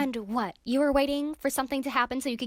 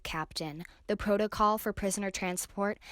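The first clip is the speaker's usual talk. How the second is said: usual speaking voice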